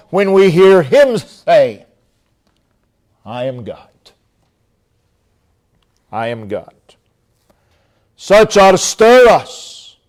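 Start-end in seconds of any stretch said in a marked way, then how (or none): 3.2-6.7 hushed voice of God. Acted. Preacher voices God's declaration.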